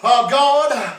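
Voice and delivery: loud, high, shouted sermon; preaching at full force. Acted. Preacher exhorting the congregation to step out in faith, shouting. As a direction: drive it home like a revival preacher.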